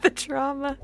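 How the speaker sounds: very high, hammed-up distress; comic high-pitched pleading; mock traumatized. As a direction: play at dreading it in a squeaky put-on voice. Mock dread of reliving trauma.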